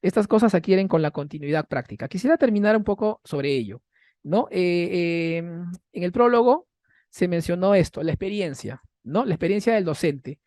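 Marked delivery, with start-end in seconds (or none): none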